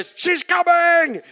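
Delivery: high, loud, drawn-out alarm; warning shout. Retold or imitated. Pupil at the window warning classmates the teacher is coming. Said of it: "then we go".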